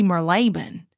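Marylebone as mur-LAY-bin; Southern mur-LAY-bin for Marylebone, stress on LAY; Southern pronunciation. Shown as a demonstration. Showing how the South says it, Marylebone as mur-LAY-bin. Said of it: "if you're in the South".

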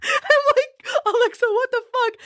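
high-pitched retold protest through laughter; laughing, incredulous squeal; laughing disbelief. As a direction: crack up while quoting your own shock. Laughing while retelling shock at Alexa.

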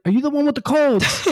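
high, far-off Schwarzenegger-style accent impression; Arnold accent. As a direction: lean into an Austrian Arnold accent. Governor repeats the question.

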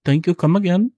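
high, put-on Indian accent. Imagining practice alone, high mock Indian accent. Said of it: "I've refrained from the Indian accents".

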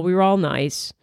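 ordinary talk, nothing marked

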